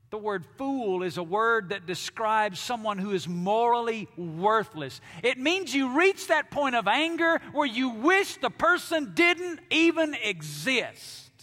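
building sermon. Acted. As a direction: let it swell like anger spilling out. Preaching at full force.

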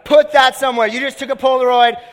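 loud, high instruction to the whole room; calling out. Real, not acted. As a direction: shout over the crowd. Calling over the room after photo.

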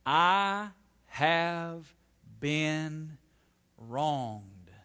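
aggrieved, each word set apart; wronged attitude. Acted. Preacher voicing the attitude of unrighteous anger, deliberately aggrieved. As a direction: state the grievance word by word. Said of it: "Here's the attitude of unrighteous anger".

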